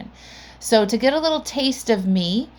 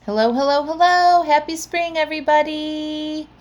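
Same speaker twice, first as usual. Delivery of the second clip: excited greeting; 9 semitones higher than usual. Real, not acted. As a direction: build each hello higher and brighter. Cheery greeting to listeners.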